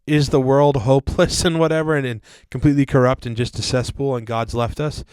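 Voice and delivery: loud, hammed-up cliffhanger voice-over; mock announcer. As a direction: tease the next episode loudly, breaking into a laugh. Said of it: "you sound like the".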